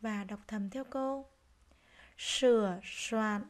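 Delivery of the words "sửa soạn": "Sửa soạn" very slow, each S strongly hushed; careful S sound. Shown as a demonstration. Teacher modelling "Sửa soạn" for pupils, careful drawn-out S. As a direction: lean on the S starting both words of "Sửa soạn". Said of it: "cần lưu ý phát âm sờ".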